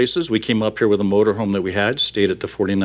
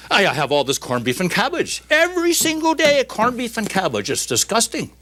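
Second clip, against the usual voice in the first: disgusted Irishman rising to a high pitch; comic exasperation, climbing on the repeat; Irish worker; 11 semitones higher than usual. Acted. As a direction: complain as a joke Irishman. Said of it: "the Irishman, of course, chimes in".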